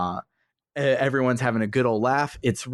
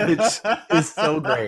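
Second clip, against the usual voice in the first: laughing; 5 semitones higher than usual. Real, not acted. Laughing at the robot impression.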